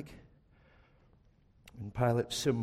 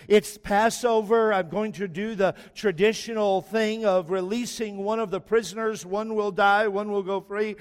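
loud, higher proclaiming governor; Pilate to the crowd; 8 semitones higher, 7 dB louder than usual. Acted.

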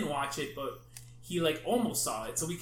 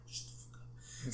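hushed, harsh whisper to a friend; urgent whisper; 13 dB quieter, faster than usual. Retold or imitated. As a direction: hiss it so nobody else hears. Hushing friend during movie.

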